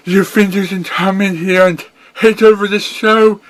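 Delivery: high, quick and indignant; defiant pony hero. Acted. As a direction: fire back at the villain. Hero defies villain.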